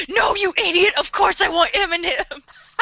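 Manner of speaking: high, indignant outburst edged with laughter; hammed-up petulant yell; mock tantrum. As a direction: snap like a bratty child. Baby bit, acted tantrum.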